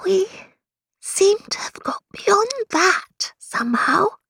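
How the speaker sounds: whispered aside, high; to herself. A narrator's reading. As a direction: breathe it to yourself, half unvoiced. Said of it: "she said to herself".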